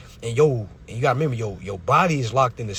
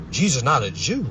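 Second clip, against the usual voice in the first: ordinary delivery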